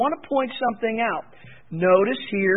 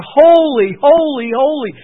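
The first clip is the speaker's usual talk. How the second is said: loud proclaiming cry, fading each time; 5 semitones higher, 8 dB louder than usual. Retold or imitated. Cherubim crying holy before God, proclaimed loud.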